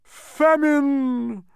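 very high, loud wail; anguished roar. A narrator's reading. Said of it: "roared the anguished humbug".

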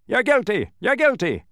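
high, loud repeated accusation; accusing shout. A narrator's reading. Officer shouting at everyone he passes, accusing shout. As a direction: shout it out like a verdict. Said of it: "stopping only long enough to shout".

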